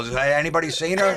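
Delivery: a lightly voiced stranger looking for his fiance; disguised guy. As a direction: play the clueless fiance in a fake mustache.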